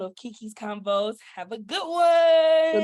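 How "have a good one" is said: loud, slow, high goodbye; shouted sign-off. Real, not acted. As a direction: belt out the farewell.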